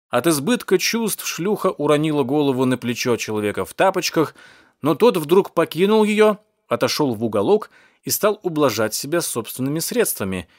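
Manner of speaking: usual speaking voice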